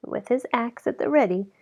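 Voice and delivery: ordinary delivery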